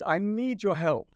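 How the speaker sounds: imitating her high, drawn-out plea; slow, high-pitched mock of a Dutch woman; high, beautifully pitched Dutch voice. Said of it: "like this".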